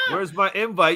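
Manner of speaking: ordinary talk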